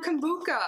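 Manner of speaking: hard K in the last syllable of kombucha. Real, not acted. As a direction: say kombucha as "kom-BOO-kuh". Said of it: "I was saying it wrong".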